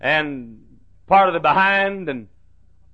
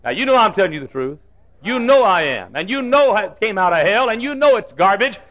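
rising, louder insistence; preaching climax; 5 semitones higher, 4 dB louder than usual. Acted. Preacher to congregation, rising preaching. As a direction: press the truth on them.

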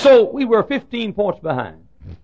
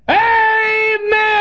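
a huge high shouted amen; preacher's booming amen; loud holler. Retold or imitated. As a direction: holler it with all you've got. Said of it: "as loud as I could holler".

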